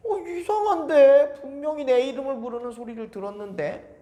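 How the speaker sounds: put-on eager boy rushing to the priest; young Samuel. 사무엘 혼잣말. (높고 큰 어린 사무엘 목소리)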